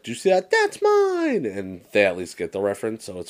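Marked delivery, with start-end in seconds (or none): 0.0-1.5 squeaky, much higher mock kid voice; imitating little Randy. Pointing at his sister, a squeaky, much higher mock kid voice. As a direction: gloat like a tiny kid. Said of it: "point at my sister and be like".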